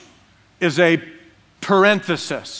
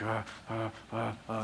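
hushed hesitation; 6 semitones lower, 17 dB quieter than usual. Retold or imitated. Hesitating before sending email.

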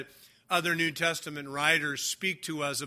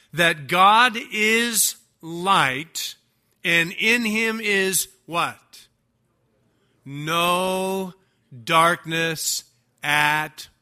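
slow, louder preaching, pressing each word; slow, louder emphatic pulpit delivery; preaching emphasis; 5 dB louder, slower than usual. Acted. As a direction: drive the verse home from the pulpit. Preacher building to the key verse.

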